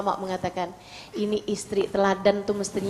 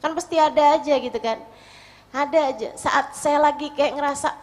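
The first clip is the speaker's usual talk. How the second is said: ordinary talk, nothing marked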